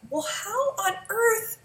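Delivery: high, disbelieving inner question; 1 incredulous. Retold or imitated. Mother reading the ingredients, disbelieving and high. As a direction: sound baffled and outraged at what you found. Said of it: "And I thought".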